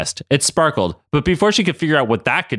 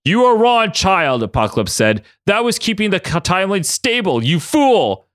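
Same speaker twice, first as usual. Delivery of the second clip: scolding villain; 7 semitones higher than usual. Acted. Apocalypse scolding Maddie.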